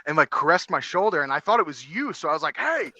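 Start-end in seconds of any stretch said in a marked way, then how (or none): 2.6-2.9 retold high-pitched surprised "hey"; startled greeting. Man retelling a stranger caressing his shoulder, startled.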